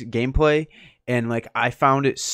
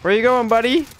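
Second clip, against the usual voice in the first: loud, high mock indignation; yelling at the teammate who stole the kill; outraged yell; 13 semitones higher, 5 dB louder than usual. Real, not acted. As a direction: shout at the teammate.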